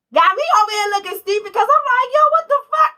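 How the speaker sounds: high, loud, incredulous cry of disbelief; outraged disbelief. Retold or imitated. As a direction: shriek it like you can't believe it. Disbelief at the gaslighting partner.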